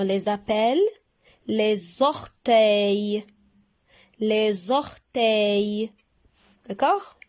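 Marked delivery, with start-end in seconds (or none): 1.5-3.3 les orteils stretched out very slowly; very slow model word. Shown as a demonstration. Teacher models the word for pupils, very slowly. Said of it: "teacher models word". 4.2-5.9 les orteils stretched out very slowly; very slow model word. Shown as a demonstration. Teacher models the word for pupils, very slowly. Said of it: "teacher models word".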